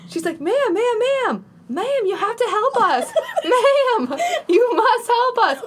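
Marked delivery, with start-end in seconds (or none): 0.4-3.1 put-on high, pleading hillbilly accent. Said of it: "why am I hillbilly". 3.4-5.6 high, drawn-out hillbilly plea; hammed-up hillbilly accent, slow and high, begging; put-on hillbilly accent. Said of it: "why am I hillbilly".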